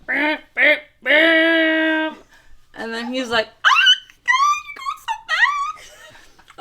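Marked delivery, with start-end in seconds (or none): 0.1-2.1 high, loud beeps, last one long; comic vocal car horn; imitated honking. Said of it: "she goes". 3.5-6.6 extremely high, thin, stretched-out squeak; cartoonishly high fake panic; mock panicked squeal. Passenger reacting to fast driving.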